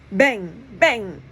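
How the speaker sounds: sharp bang sound effect; popping bang. A narrator's reading. Narrator voicing poppers going off bang. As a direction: pop it like a firecracker.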